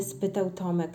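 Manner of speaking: usual speaking voice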